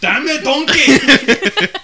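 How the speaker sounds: put-on loud, slow ogre with a Scottish accent; Shrek. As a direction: bellow at the donkey in mock anger. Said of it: "Shrek impression is literally".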